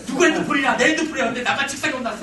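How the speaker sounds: acted indignant outburst; aggrieved protest. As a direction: protest loudly to dad. Son retells being caught, feels wronged.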